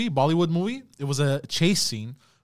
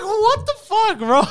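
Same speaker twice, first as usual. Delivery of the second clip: much higher, stunned disbelief; shocked; 4 dB louder, slower than usual. Retold or imitated. Retelling a wild Bollywood chase scene, stunned and high. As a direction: squeal it like you can't believe it. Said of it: "I was like".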